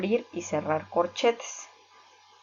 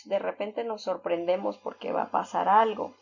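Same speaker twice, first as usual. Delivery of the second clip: usual speaking voice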